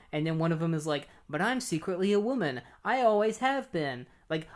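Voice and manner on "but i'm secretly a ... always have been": high, hammed-up reveal; lightly imitated high voice; mock confession. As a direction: confess it like a cheesy melodrama. Hero's secret confession, acted.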